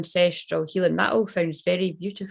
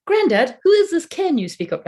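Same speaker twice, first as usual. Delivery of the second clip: high, louder mock child's question; imitated wee child; 11 semitones higher, 5 dB louder than usual. Wee grandchild asking granddad about a word, imitated child voice. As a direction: pipe up high like a puzzled child. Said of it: "to my granddad when I was wee".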